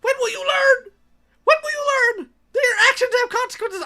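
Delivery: hammed-up sobbing high-pitched rant; wailing YouTuber quote. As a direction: wail it like a meme, mock anguish. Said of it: "in the words of the old YouTuber".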